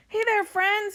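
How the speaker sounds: high, singing-out call; storybook yell. A narrator's reading. Captain hailing the sea monster, high friendly yell. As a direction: hail a friend across the water. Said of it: "he yells to the sea monster".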